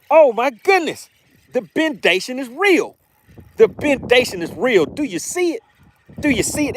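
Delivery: high, thrilled exclaiming; excited. Real, not acted. Host reeling in a fighting bass for the viewers, thrilled and high.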